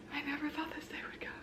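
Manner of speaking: whispered, quick and hushed; awed whisper. Real, not acted. Vlogger overwhelmed by passing, awed whisper. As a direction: breathe it out in disbelief.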